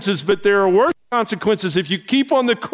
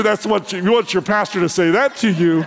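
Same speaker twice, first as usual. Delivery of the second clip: ordinary delivery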